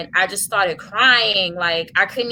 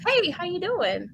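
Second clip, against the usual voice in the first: chirpy driver; 11 semitones higher than usual. Retold or imitated. Cab driver greets crying passenger, chirpy and high. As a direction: imitate a breezy driver saying hello.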